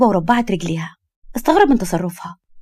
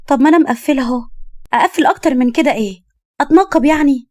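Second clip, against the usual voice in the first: higher, louder indignant protest; defensive; 5 semitones higher, 3 dB louder than usual. Acted. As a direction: climb towards an exasperated question. Young woman defends her dress.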